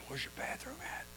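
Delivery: hushed, embarrassed ask; whispered question. Retold or imitated. Customer to waitress, whispering for the bathroom. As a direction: whisper it discreetly.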